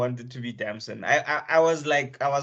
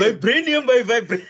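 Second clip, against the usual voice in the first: laughing mock line; 8 semitones higher, 5 dB louder than usual. Repeating joke line laughing.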